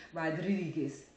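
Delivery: Rodriguez with a rolled r. Shown as a demonstration. Showing viewers she can roll the r in Rodriguez. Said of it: "I just did it by mistake".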